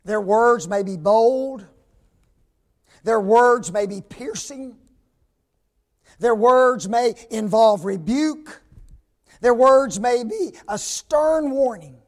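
forceful preaching; emphatic build. Acted. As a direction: drive every phrase home. Building list about bold preaching.

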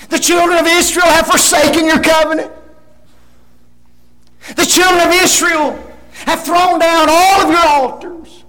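loud, high-pitched protest; hammed-up litany of grievances; acted complaint. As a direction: complain loudly, piling up each grievance. Preacher acts out Elijah's complaint.

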